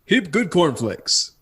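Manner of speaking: ordinary delivery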